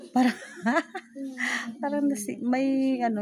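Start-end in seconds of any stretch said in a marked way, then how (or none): 0.4-1.8 short disbelieving laugh; incredulous laugh. Real, not acted. The vlogger laughs at the girl's story in disbelief. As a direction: laugh off how absurd it is.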